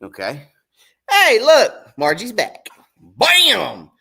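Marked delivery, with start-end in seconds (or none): 1.1-3.9 loud, high and thrilled; excited reveal. Real, not acted. Angler revealing his boat to viewers, loud and thrilled. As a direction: show it off with a big bam.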